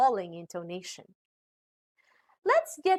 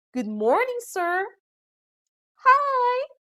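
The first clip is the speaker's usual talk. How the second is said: "morning, sir" lifted high, "Hi" held high and louder; rising greeting; 13 semitones higher, 3 dB louder, slower than usual. Shown as a demonstration. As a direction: greet with pitch climbing on "sir" and a high, long "Hi". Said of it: "rising intonation when we are greeting someone like".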